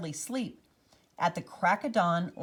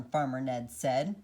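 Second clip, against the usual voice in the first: ordinary delivery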